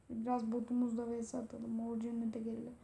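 soft whispered aside. Real, not acted. Gamer whispering to himself while entering the map. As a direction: breathe it to yourself. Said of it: "whispered aside".

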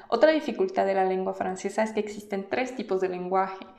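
ordinary talk

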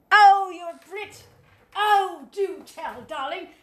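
high, gushing mock-British; exaggerated British socialite accent. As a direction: play it up as a posh Brit, loud swoop on the first word. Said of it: "I've been practicing my accent".

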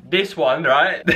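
normal talking voice

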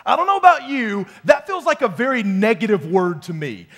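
loud, ringing preacher voice; rising preacher's fervor; full-force preaching. Acted. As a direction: preach it out to the room.

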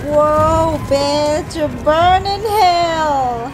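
loud, slow, savoring curse; burn in hell, slow and loud; gloating cheer. Real, not acted. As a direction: drag it out, loud and gleeful. Cheering the dragon on.

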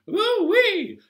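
squealed very high, loud hoo-wee; a gleeful falling whoop. Acted. Wiggling hips in the chant, squealed whoop.

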